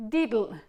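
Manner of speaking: diddle, loud and clipped on the dl; diddle demo. Shown as a demonstration. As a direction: say diddle as a tonguing drill. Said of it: "So that's D I D L, diddle".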